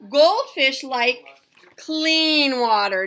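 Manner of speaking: Goldfish like clean water, drawn out slowly with every word stressed; slow emphatic answer. Shown as a demonstration. Teacher answering the book's question for her class, slow and emphatic. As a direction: spell the answer out slowly for the kids.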